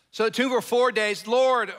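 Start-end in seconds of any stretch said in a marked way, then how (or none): 1.3-1.7 loud, high plea to the Lord; pleading cry. Acted. Martha cries out to Jesus, loud and high. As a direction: call out to him, hurt and urgent. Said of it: "Martha said to Jesus".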